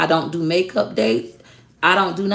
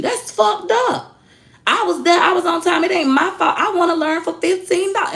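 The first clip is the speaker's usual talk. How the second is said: squeaky whiny customer; 13 semitones higher than usual. Retold or imitated. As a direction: imitate the upset customer, pitched way up. Customer's complaint voiced again.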